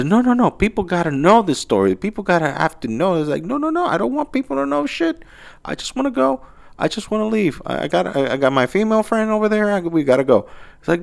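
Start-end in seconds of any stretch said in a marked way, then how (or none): 0.0-3.1 much higher, eager no, no, no; excited insistence. Retold or imitated. Pizzeria staff excitedly insisting the story goes public, high pitched. 3.4-10.5 much higher, strained pleading voice; anxious refusal. Retold or imitated. Honest guy nervously begging staff to let him go, high voice.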